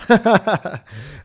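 high, loud surprised laugh; burst of laughter. Real, not acted. Phone finally transcribes his French, loud laugh.

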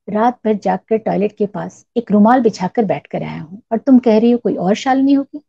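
plain reading voice

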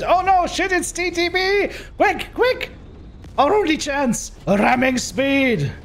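high, frantic pilot yelling orders; panicked pilot voice. Retold or imitated.

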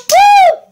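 loud, very high "woo"; high whoop. Real, not acted. Vlogger cheering after announcing her dance challenge, high whoop. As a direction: let out a piercing cheer.

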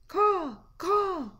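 two high, loud caws; piercing bird cry, repeated; imitated crow caw. Shown as a demonstration. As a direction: call out like a crow.

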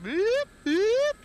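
two loud, high-pitched beeps; imitated beeps. Showing how the helicopter's warning went, high imitated beeps. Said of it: "That was more like".

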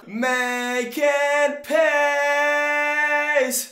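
high laughing shout, slowly stretched. Real, not acted. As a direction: shout it while cracking up.

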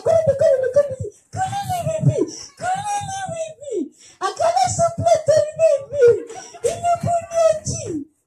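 very high, silly cheering voice; hammed-up high-pitched race call; squeaky comic urging. Shown as a demonstration. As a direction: squeal the instructions like a cartoon. Demonstrating the relay with silly urging.

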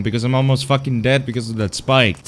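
ordinary delivery

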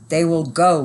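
loud, emphatic decree; forceful narrated boom. A narrator's reading. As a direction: boom it out as a final order. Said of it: "boomed the knight".

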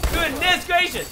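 high, loud, drawn-out cry; amazed exclamation. Real, not acted. Gamer amazed by the fight, loud exclamation.

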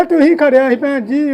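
ordinary talk

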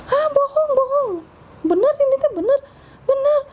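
high squealing disbelief, half-screamed; a cousin shrieking at midnight news, high and excited. Retold or imitated. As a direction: shriek it out in joyful shock. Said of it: "sampai ngejerit gitu kan".

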